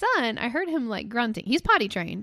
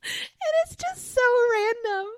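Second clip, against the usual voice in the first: laughing hard; 12 semitones higher, slower than usual. Real, not acted. Laughing hard about the poop breaks.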